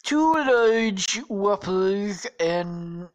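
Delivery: high, loud, very slow order; hammed-up presidential drawl; ordering as Trump. Trump orders at Burger King, slow hammy impression. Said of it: "they're ordering".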